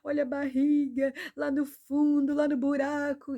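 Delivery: a mother's mock sob to God; desperate prayer, a touch tearful and loud; retold crying prayer. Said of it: "eu tava lá chorando".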